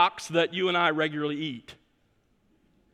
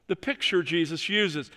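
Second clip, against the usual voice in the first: usual speaking voice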